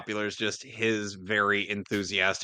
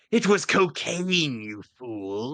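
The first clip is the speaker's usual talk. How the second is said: villain voice; 4 semitones higher, slower than usual. Acted. Quoting Snowflame villain line.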